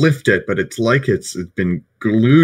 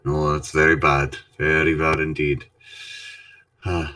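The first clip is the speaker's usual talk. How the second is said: low, soft, ominous agreement; grave dwarf; 7 semitones lower, 6 dB quieter, faster than usual. Acted. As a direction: mutter gravely to a friend. Player answers as the dwarf.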